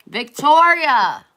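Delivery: calling a viewer to pick a number. Real, not acted. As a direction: call her name across the room.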